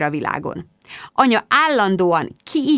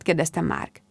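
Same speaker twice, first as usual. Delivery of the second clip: ordinary delivery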